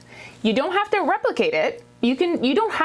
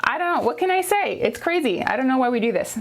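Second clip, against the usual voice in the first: ordinary talk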